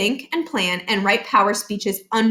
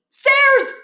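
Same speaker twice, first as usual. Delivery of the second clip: loud, high exclamation; sudden realisation; 6 dB louder than usual. Acted. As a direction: blurt it as it dawns. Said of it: "Barkin reminded himself".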